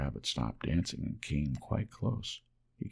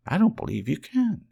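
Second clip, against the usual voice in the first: high, louder teasing rabbit voice; doubting furry rabbit; 10 semitones higher, 8 dB louder than usual. A narrator's reading. Wild rabbit doubts the toy rabbit, high teasing voice. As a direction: tease him in a high rabbit voice. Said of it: "he said".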